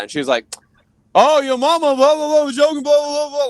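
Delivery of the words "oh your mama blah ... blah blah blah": high, loud gibberish tirade; shrieky mock yelling, blah-blah style; loud mocking rant. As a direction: imitate her yelling in a babbling stream. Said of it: "started getting loud".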